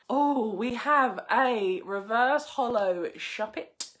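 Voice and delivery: ordinary delivery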